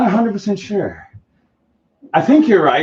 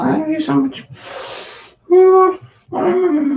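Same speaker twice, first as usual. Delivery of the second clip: high, giggly voice for the cat as HR rep; a high, playful made-up cat persona; cat's HR voice; 10 semitones higher than usual. Pretend. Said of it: "Panther from H R".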